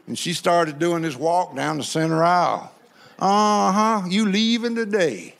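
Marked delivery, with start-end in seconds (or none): 3.2-5.3 lightly voiced sassy sister shooing the devil; a hint of strutting church lady, ending in a laugh.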